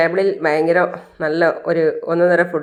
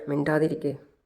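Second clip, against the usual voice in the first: a soft, hushed warning under her breath; 3 semitones lower, 8 dB quieter than usual. A narrator's reading. Revathi hushing her mother at the table, softly. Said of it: "Revathi മെല്ലെ പറയാണ്".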